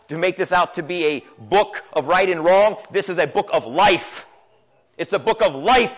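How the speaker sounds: impassioned preacher, rising and pressing; preaching at full force. Acted. Pastor urging the congregation, fervent preaching. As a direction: drive the sermon home to the church.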